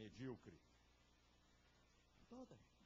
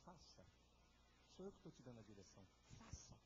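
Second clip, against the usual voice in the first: soft inner voice; 3 semitones lower, 6 dB quieter than usual. Acted. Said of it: "lá no fundo, dizendo assim".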